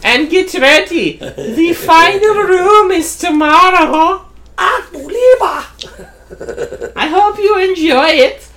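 high, slow mock German villain voice; hammed-up German accent over a loudspeaker. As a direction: taunt the players in a hammy German accent. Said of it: "What accent are you doing there".

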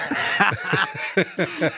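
high unvoiced laugh; breathy laugh. Real, not acted. Host laughing hard at the listener's cheeky line. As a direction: lose it at the insult.

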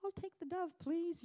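put-on high, polite, small girl voice; sweet little voice. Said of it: "she's like".

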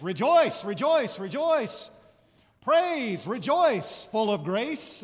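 loud, high, jubilant call; joyful rejoice. Shown as a demonstration. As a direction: burst out with joy. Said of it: "You could also translate it as".

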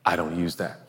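ordinary delivery

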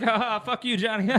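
loud, high laughing yell; mock yell. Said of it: "just be like".

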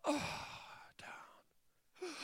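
gasped, whispered exhale; faint, airless whisper after great effort; breathless collapse. Acted. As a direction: let the breath drain away as the body sags. Enacts sagging and gasping for air.

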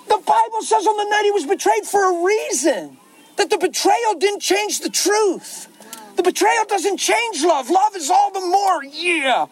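very high, ringing exhortation from a preacher; full-force preaching. Real, not acted. Preacher urging that betrayal doesn't change love, full-force exhortation. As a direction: preach it high, stretching the key words.

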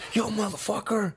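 high-pitched mock challenge; retold confrontational friend. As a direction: act out a friend stepping in to confront.

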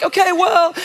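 buy time, squeaking; flustered stall. Retold or imitated. Awkward fumbling on phone.

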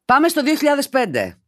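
high, ringing announcement; excited announcement. Acted. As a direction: launch it like a big flashback. Announces the story, then reads again.

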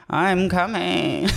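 put-on slow, drawn-out Canadian accent. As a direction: stretch it out in a lazy Canadian accent. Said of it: "that annoying Canadian accent".

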